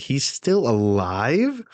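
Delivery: slow, stretched, incredulous question; can't quite believe it, drawn out; mild mock disbelief. Onlookers react to him getting up.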